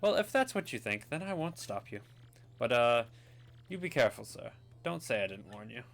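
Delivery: swooping, put-on posh voice; a doubtful, courteous posh warning; posh sailor. Sailor warning Mario about Rogueport, in a posh voice.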